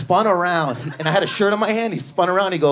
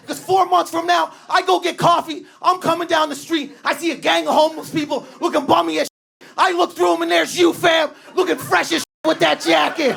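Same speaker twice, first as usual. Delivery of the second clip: high mock clerk shouting; a high, dismissive clerk's shout; 10 semitones higher than usual. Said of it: "He goes".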